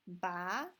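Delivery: loud uphill glide on "ba"; second tone. Shown as a demonstration. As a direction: swing "ba" upward like a question. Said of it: "one, two, three".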